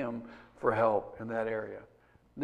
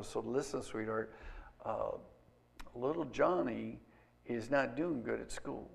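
gentle grandma; 4 semitones lower, 10 dB quieter than usual. Retold or imitated. Grandma talking to daughter.

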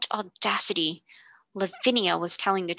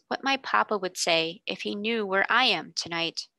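usual speaking voice

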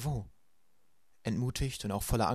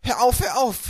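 much higher, loud desperate scream; screaming stop; 13 semitones higher, 11 dB louder, slower than usual. A narrator's reading. Violet screams at Klaus to stop, ears covered. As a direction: cry out to make him stop. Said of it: "schrie Violet".

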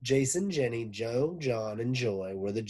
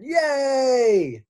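put-on high loud kids' cheer; a burst of class joy; children cheering about an experiment, loud and high; 13 dB louder than usual. A narrator's reading. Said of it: "The children cheered".

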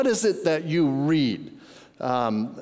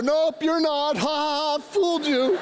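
high, teasing mock gloat; mock taunting; 10 semitones higher than usual. Grandpa pretending to fool his little granddaughter, mock taunting. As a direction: taunt it like a playground tease.

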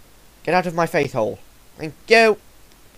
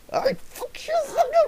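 high yelp. Real, not acted. Yelps as friend attacks him.

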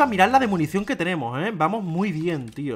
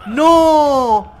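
loud; 9 semitones higher, 10 dB louder than usual. Real, not acted. Dying in game.

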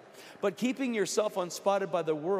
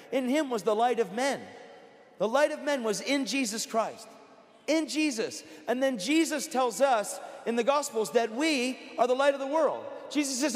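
high, loud, fervent pulpit voice; rising revival preacher's cry; full-force preaching; 6 semitones higher, 5 dB louder than usual. Acted. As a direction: preach it out to the whole room.

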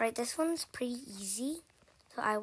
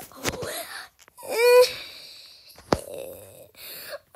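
crying gasp; 11 semitones higher, 10 dB louder than usual. Real, not acted. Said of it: "I am crying right now".

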